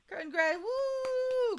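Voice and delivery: high whoop, slow and stretched; excited whoop. Real, not acted. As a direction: let out a celebratory woo. Celebrating finished exams.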